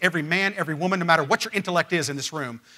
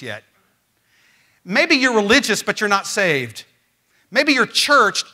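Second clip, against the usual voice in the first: impassioned preaching; 4 semitones higher, 7 dB louder than usual. Acted. Pastor challenging churchgoers before invitation, impassioned preaching. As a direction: press each maybe on the listeners.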